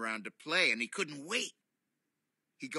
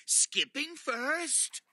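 character voice; 12 semitones higher, 4 dB louder, much slower than usual. Acted.

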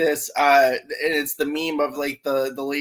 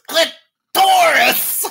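high, loud, drawn-out "Clitoris!"; Gilbert Gottfried; 9 semitones higher, 8 dB louder, much slower than usual. Retold or imitated. Imitating a celebrity audiobook narrator, shouting "Clitoris!" slowly. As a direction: shout "Clitoris!" as CLIT-TORIS, stretching both halves. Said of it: "He actually said it like this".

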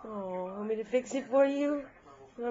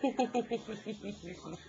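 warm mom laugh; 5 dB quieter than usual. Real, not acted. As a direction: laugh gently, full of love.

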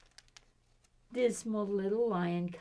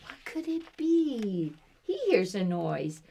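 plain reading voice

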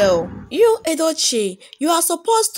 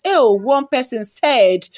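ordinary talk, nothing marked

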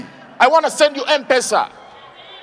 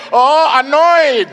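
mock annoyed shout; 2 semitones higher, 7 dB louder, much slower than usual. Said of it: "show me that you're angry, you're annoyed".

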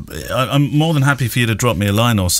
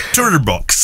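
mock Swedish; 4 semitones higher than usual. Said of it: "you made it sound Swedish".

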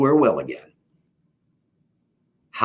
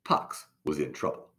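usual speaking voice

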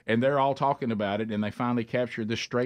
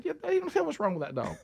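mock innocent voice; 13 semitones higher, 4 dB quieter than usual. Sarcastic quote to sister's friends.

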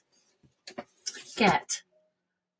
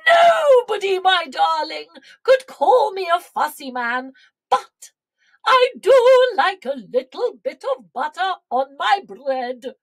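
high, loud storybook proclamation; jubilant king; 8 semitones higher, 5 dB louder than usual. A narrator's reading. King tells his darling about butter, jubilant. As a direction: declare it grandly, full of glee.

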